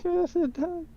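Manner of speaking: high squeaky quoted line; quoting a commercial. Retold or imitated. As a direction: pitch it way up like the ad. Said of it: "I was just quoting that commercial".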